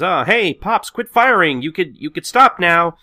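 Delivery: loud, high call to someone far off; yelling up. Acted. Kondo calling up to the ship to stop firing, yelling. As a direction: holler it up at the ship. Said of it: "he yells to the ship".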